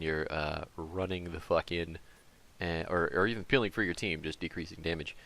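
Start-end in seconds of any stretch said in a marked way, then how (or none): none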